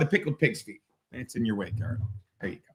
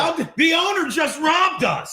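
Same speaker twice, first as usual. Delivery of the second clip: mock outrage; 9 semitones higher than usual. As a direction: shout it like you've been cheated. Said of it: "I said that all the time at Sullivan's".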